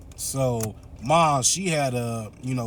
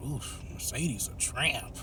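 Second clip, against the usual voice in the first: softer, slow sneering murmur; catty aside; 9 dB quieter, slower than usual. Retold or imitated. Maggie's mom judges Mercedes, a slow catty murmur. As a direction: dish the gossip under your breath.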